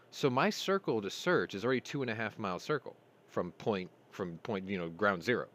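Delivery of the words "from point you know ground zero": ordinary talk, nothing marked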